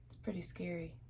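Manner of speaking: hushed, voiceless aside; whispered aside. Real, not acted. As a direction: breathe it like a spooky secret. Reader's spooky aside to listener.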